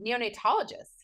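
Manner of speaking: normal talking voice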